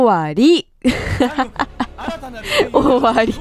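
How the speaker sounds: laughing in disbelief. Real, not acted. Streamer laughs as the story suddenly ends. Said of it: "言ってたら終わっちゃった".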